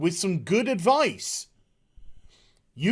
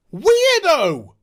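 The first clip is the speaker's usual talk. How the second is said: higher, louder jeering weirdo; mocking weirdo call; 6 semitones higher, 6 dB louder than usual. Real, not acted. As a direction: call her a weirdo, loud and high.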